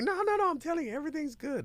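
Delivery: mimicking an overconfident business owner; overconfident client. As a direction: wave off the warning, cocky. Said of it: "they're like".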